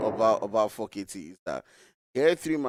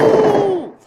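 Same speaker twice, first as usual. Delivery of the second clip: excited ooh; 12 dB louder than usual. Real, not acted. Caster reacts to a kill.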